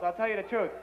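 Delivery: ordinary talk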